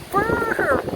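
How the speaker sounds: shivering brrr from the cold; high, rattling shiver sound. Real, not acted. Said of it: "I say "burr" a lot".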